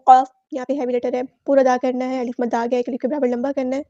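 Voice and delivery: ordinary talk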